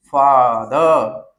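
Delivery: फादर drawn out slowly, FA then DER; syllables split. Shown as a demonstration. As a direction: stretch फादर into two clear beats. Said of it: "इसे एक बार फा पढ़ रहे हैं". (फादर को धीरे, फा-दर दो हिस्सों में)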